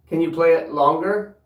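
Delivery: loud, high call into the house. Real, not acted. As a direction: project to the spirits upstairs. Calling out to spirits.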